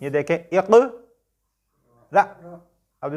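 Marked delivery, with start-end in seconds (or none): 0.0-1.0 इक cut off with a sudden catch; hamza jolt. Shown as a demonstration. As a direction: stop इक abruptly on the glottal. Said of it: "हमजा साकिन बताया हुआ".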